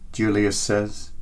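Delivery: ordinary talk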